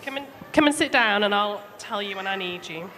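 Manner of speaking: ordinary delivery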